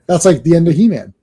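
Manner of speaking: normal talking voice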